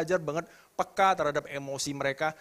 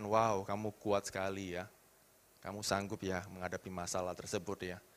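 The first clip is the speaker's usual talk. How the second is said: affirming; 9 semitones lower, 8 dB quieter than usual. Retold or imitated. Mother affirming child.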